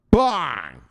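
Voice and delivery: loud, punched exclamation; showman's shout; explosive catchphrase. Pretend. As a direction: fire it off like a gunshot.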